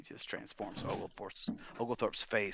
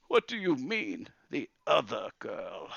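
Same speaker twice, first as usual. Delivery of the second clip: put-on baffled old wizard after tears; puzzled; 5 semitones higher, 6 dB louder than usual. Wizard asking Calamus about the other girl, puzzled.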